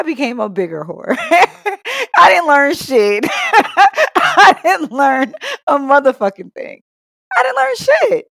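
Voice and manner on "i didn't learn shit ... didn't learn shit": laughing. Real, not acted. Laughing, high, slow.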